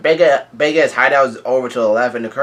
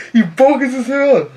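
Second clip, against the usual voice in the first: high, loud laughing remark; real laughter, pitch shooting up; 12 semitones higher, 6 dB louder than usual. Real, not acted. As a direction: crack up while saying it. Laughing at the character's insult.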